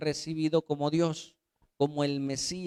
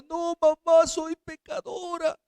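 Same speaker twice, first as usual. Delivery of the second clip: put-on high, loud sobbing child's plea; a nine-year-old crying out to her mother; crying little girl; 12 semitones higher, 4 dB louder than usual. Girl sobbing to her mother over her sins, high and pleading. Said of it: "esa niña llorando decía".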